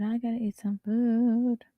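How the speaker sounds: said aloud, slowly drawn out; slow normal voice. Shown as a demonstration. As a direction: say it aloud, unhurried.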